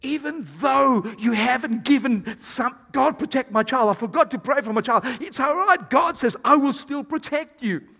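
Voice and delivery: fervent pulpit proclamation; impassioned preaching. Real, not acted. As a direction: preach it loud and high, driving it home. Preacher builds to God's reassurance.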